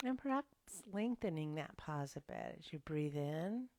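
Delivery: ordinary delivery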